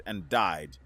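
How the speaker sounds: ordinary delivery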